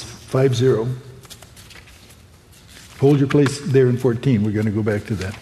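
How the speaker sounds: normal talking voice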